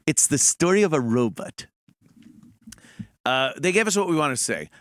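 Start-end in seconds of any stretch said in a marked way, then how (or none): 0.1-1.7 Rod Serling. Retold or imitated. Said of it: "That's what Rod Serling said".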